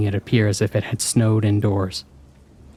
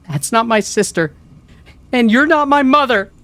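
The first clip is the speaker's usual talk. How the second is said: high scream, building louder; 14 semitones higher, 6 dB louder than usual. A narrator's reading. Child to the thing outside the door, screaming. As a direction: scream it in defiance, rising to full force. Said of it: "I screamed through the door".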